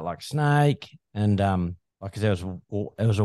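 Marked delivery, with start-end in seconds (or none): 0.2-1.0 mock yell, higher pitched; imitated shout of alarm, pitched up; retold warning yell. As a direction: call out a warning, re-enacted without full force. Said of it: "turned around and yelled out".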